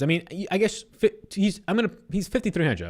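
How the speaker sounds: tripping over the words; stammering restarts; stuttering. Real, not acted. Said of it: "I'm stuttering everywhere".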